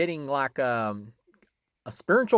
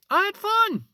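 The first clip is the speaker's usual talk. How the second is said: excited; 6 dB louder, slower than usual. Real, not acted. Enthusing about the movie.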